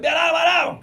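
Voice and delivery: loud, high shout imitating a singer on stage. Singer calling his friend out from the stage, imitated shout. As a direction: call the full name out like a summons. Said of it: "ele falava".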